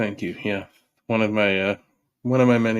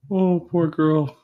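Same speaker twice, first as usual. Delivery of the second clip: slow, higher, louder mock sympathy; mock pity; 8 semitones higher, 5 dB louder, slower than usual. Podcast host mocking the woman in the clip, slow mock pity. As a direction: coo it with fake pity.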